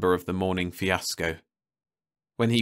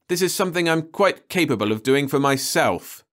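higher and louder, indignant; angry; 8 semitones higher, 5 dB louder than usual. A narrator's reading. Perry to the serval, angrily refusing help. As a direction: insist on doing it himself, angrily. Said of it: "he said angrily".

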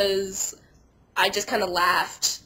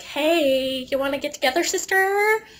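higher put-on creep voice, rising at the end; sleazy come-on; 6 semitones higher, 4 dB louder than usual. Fat dude by the elevator trying to pick up a girl, mock sleazy come-on. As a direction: imitate a man hitting on someone, pitch climbing to the last word. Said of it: "trying to come on to me".

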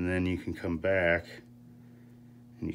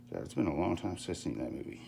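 ordinary talk

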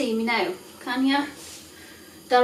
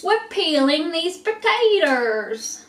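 drawn-out, higher put-on delivery; odd put-on voice; 4 semitones higher, slower than usual. Home cook announcing the potato peeling to viewers, silly voice. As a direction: stretch it out slowly in a silly put-on voice. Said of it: "Don't know why I said it like that".

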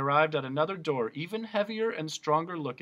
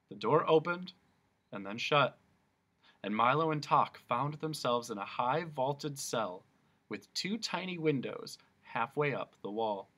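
plain reading voice

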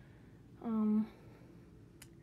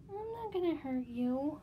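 reassuring the bird; 5 semitones higher than usual. Real, not acted.

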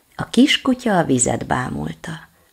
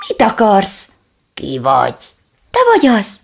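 frightened questions; 5 semitones higher, 6 dB louder than usual. Acted.

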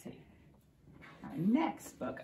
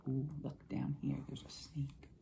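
hushed spooky whisper; pointing at the picture in a whisper; 5 semitones lower, 9 dB quieter than usual. Real, not acted. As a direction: whisper it like the snake might hear.

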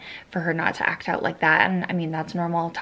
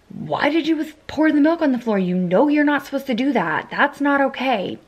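higher and louder, an exasperated mom scolding; frustrated scolding; 7 semitones higher, 4 dB louder than usual. Pretend. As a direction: pretend to snap at the toddler over spilled milk. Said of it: "I would have gotten frustrated".

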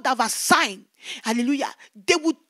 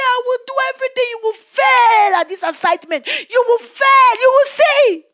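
very high, loud mocking enemy voice; Satan jeering at the preacher, high and loud; Satan taunting; 10 semitones higher, 8 dB louder than usual. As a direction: sneer the threat like a gloating devil. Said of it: "He was laughing at me".

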